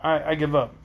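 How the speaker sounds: plain reading voice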